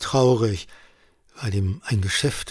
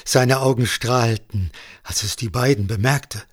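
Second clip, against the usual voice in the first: ordinary delivery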